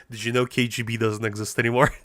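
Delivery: quick, mocking "did you know" back at chat; teasing comeback. Real, not acted. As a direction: rattle it off fast, ribbing the viewer. Teasing reply to chat question.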